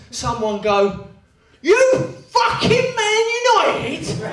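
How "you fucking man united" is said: high, slow, hammed-up thug roar; Bill Sykes voice. Angry fan in the pub corner bellowing like Bill Sykes. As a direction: roar it like a Dickensian brute. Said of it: "described as a Bill Sykes voice".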